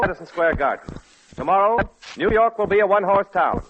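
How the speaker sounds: ordinary talk, nothing marked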